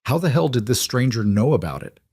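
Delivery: ordinary delivery, nothing marked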